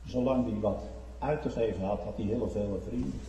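usual speaking voice

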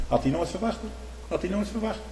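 quiet mock pity; ironic aside. Real, not acted. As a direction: say it with wry irony.

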